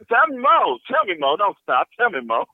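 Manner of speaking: high, eager mock plea; eager lapping-up. Client lapping up masseuse's flattery, playful eager plea.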